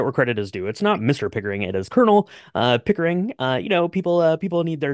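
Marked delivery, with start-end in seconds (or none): 1.6-3.3 Colonel pushed higher and louder; stress on Colonel. Real, not acted. Co-host corrects the title of Pickering, stress on Colonel. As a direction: correct them by hitting Colonel high and hard.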